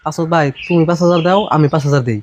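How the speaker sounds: very low-pitched conferring voice; private aside. Acted. Man proposes splitting the bribe, private aside. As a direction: settle it discreetly between the two of you. Two men privately agree to pay.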